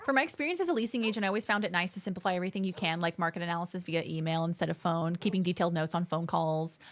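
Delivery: rattles off the message fast; fast reading. Real, not acted. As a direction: read it out quickly.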